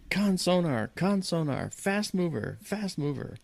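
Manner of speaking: calling out the contact across the control room; retold yell. Sonar man yells out a fast contact.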